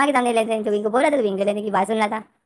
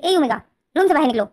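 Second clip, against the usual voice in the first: loud call to a closed room; calling through the house to come out; 5 semitones higher, 5 dB louder than usual. Retold or imitated. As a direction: shout her out of the room. London calls out to Omega.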